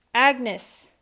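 loud call across the house; loud summoning shout. Acted. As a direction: bark the maid's name like calling a dog. Butler summoning maid like dog.

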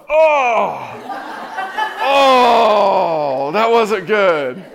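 high, loud embarrassed laugh through the words; a hammed-up wince with laughter; laughing cringe. Awkward rejection, laughing groan.

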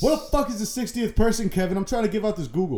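loud high exasperated yell; frustrated shout. Real, not acted. Cohost yells at Kevin after losing the round, loud and high. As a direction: shout in disbelief at the failure. Game round just failed.

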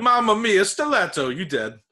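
loud, high stage-Italian threat; hammy boisterous chef. Acted. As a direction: play a cartoon Italian chef with a knife. Mock Italian knife fighter.

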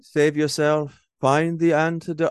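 hammed-up heroic farewell plea; Frankenstein's monster. Imitating the monster's tearful last plea.

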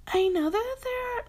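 very high, soft breathy imitation of [name]; attempted high [name] voice; [name] impression. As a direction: try a high, girly pop-star voice and give up. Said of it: "should I try to do a, an [name] voice?".

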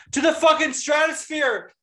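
high, hyped-up declaration; excited proclamation. Real, not acted. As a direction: proclaim it like a ringside hype man. Hyped answer to fan question.

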